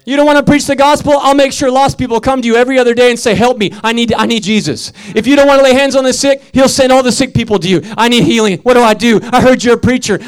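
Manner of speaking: driving, insistent sermon delivery; forceful preaching. Real, not acted. Preacher warning the congregation they cannot run, forceful preaching. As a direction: preach it hard, phrase after phrase.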